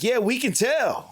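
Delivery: high, loud, slow mock jab; taunting announcer. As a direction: drawl the insult from the machine. Machine taunts player.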